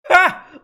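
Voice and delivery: one loud high bark of a laugh; loud sharp cackle. Acted. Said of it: "barked out a single laugh".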